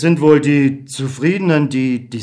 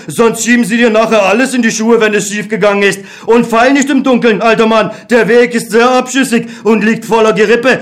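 higher, louder, faster contempt; scornful dismissal; 7 semitones higher, 5 dB louder than usual. Acted. Beckmann dismisses God with scornful, loud contempt. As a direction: send the old man away with mockery.

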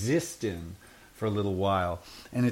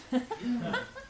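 squeaky laugh; 4 dB quieter than usual. Acted. Tramp's sly laugh.

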